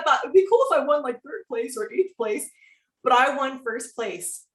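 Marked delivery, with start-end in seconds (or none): none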